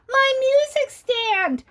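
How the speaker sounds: very high, slow bird cry; chirpy bird. Acted. The bird chirping as the tree sways, high. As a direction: squeak it out like a startled little bird. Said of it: "chirped the bird".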